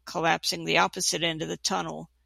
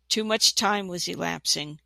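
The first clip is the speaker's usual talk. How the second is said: usual speaking voice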